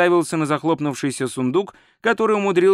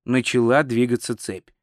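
usual speaking voice

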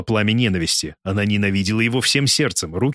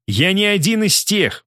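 yelled higher, dragged out slowly; nearly shrieking in wounded pride; slow, high, outraged cry of Я не один; near-squealing yell; 9 semitones higher, slower than usual. A narrator's reading. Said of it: "почти заверещал Кельт".

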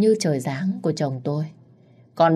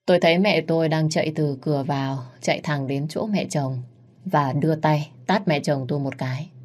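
ordinary delivery, nothing marked

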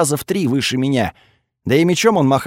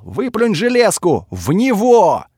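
yelling; 6 semitones higher, slower than usual. Acted. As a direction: yell the command over the noise. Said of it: "и заорал".

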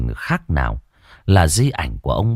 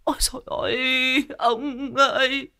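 wailing lament; 3 dB quieter than usual. Acted. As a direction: sob and wail to the heavens for the dead husband. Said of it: "kêu gào thảm thiết". (gào khóc thảm thiết, giọng cao kéo dài)

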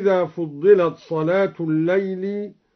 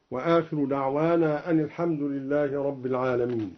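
normal talking voice

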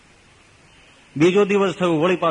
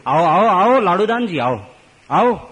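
hearty welcome; 6 semitones higher, 5 dB louder than usual. Acted. Maharaj welcoming the poet.

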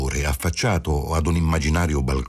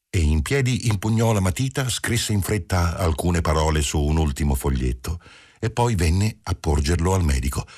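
usual speaking voice

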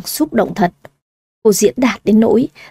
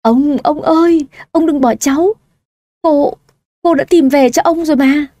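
wailing; 5 semitones higher than usual. A narrator's reading. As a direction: cry out to grandpa, grief-stricken. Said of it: "ôm lấy cổ ông nội mình và gào khóc". (cháu gào khóc bên giường ông nội, giọng cao và than khóc)

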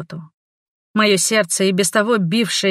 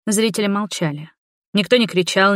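ordinary delivery

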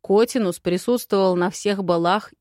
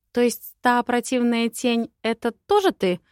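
dawning realization; 5 semitones higher, 5 dB louder than usual. Acted. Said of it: "догадалась я".

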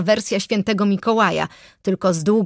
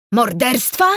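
raised, roared question; 4 semitones higher, 4 dB louder, slower than usual. A narrator's reading. Forester reacts to news of a murder, roaring. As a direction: bellow it as a startled question. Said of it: "ryknął w końcu".

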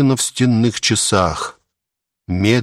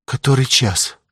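high, plaintive question; quiet plaintive voice; 4 semitones higher than usual. A narrator's reading. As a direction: ask weakly and pitifully from a sickbed. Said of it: "проговорила тихим жалобным голосом".

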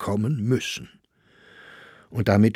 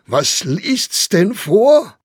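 much higher, louder, slow mocking disbelief; mocking Grossinger; 12 semitones higher, 7 dB louder, slower than usual. A narrator's reading. Grossinger mocks the idea of a public reading. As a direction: ham up the incredulity.

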